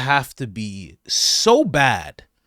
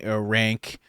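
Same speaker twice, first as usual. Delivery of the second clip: normal talking voice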